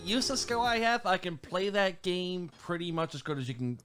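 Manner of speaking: ordinary talk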